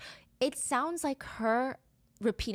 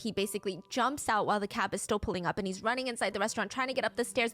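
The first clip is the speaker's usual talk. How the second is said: usual speaking voice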